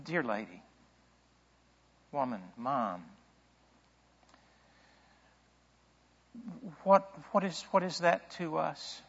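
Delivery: soft, tender son to his mother; quiet, affectionate address to a mother; respectful, loving. Acted. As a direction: say it gently and respectfully, with long pauses. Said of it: "respectful son to his loving mother".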